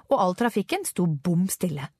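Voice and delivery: ordinary delivery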